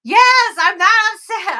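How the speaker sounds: loud, high exasperated comeback; retold mad outburst. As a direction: shout it back at mom, mock-indignant. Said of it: "being so mad at my mom".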